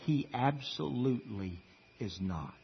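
low, soft, firm "absolutely is not"; weighty, hushed denial on "is not"; quietly emphatic. Real, not acted. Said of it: "emphatically and dogmatically as I can".

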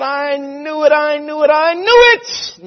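rising from loud to a high, slow cry; frustrated outburst building. Retold or imitated. Jonah protesting to God, building to an exasperated high cry.

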